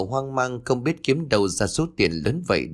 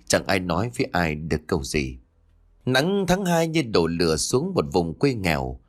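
ordinary delivery

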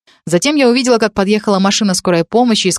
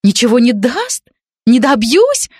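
high, mildly raised, on edge; nervous shout; 5 semitones higher, slower than usual. Acted. Jealous girl flinging Dan's words back, a mild nervous shout. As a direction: throw his words back at him, rattled. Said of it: "уже нервно закричала девушка".

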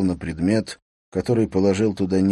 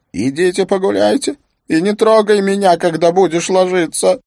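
furious high shriek; angry shouting; 12 semitones higher, 8 dB louder than usual. Acted. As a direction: scream it at him, out of patience. Wife yelling, slams door.

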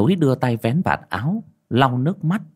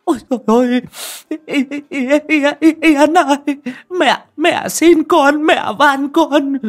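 high, loud, wailing scream, drawn out and sobbing; a mother screaming and begging a ghost to leave; shrieking in terror; 7 dB louder than usual. Acted. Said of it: "miệng hét toáng".

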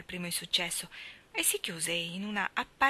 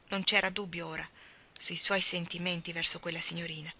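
plain reading voice

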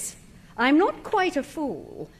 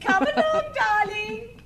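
amused laugh; 9 semitones higher, slower than usual. Acted. Wife laughing while leaving.

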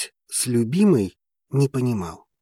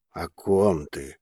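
slow, lower, puzzled question; 6 semitones lower, 5 dB quieter, slower than usual. A narrator's reading. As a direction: ask blankly, not following. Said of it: "недоумённо поинтересовался вулкан".

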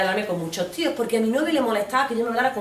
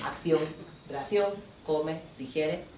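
usual speaking voice

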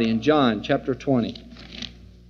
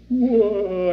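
mock ghost whoosh; 4 semitones higher than usual. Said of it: "They think he went".

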